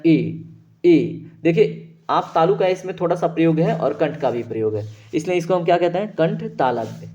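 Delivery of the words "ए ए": ए repeated slowly with the palate; e sound demo. Shown as a demonstration. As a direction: sound ए again and again using the palate. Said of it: "तालु का प्रयोग हम कर रहे हैं". (ए को तालु और कंठ से बार-बार बोलकर)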